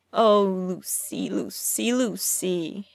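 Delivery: put-on slow, teasing sing-song of a childish nickname; lilting mock-friendly taunt; amiable sing-song. A narrator's reading. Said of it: "Loki said amiably".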